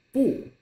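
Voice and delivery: high, short falling 不; teacher's emphatic falling 不; 不 in fourth tone. Shown as a demonstration. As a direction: drop sharply on 不. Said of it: "you read them all".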